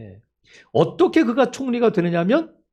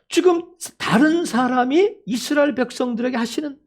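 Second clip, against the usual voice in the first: normal talking voice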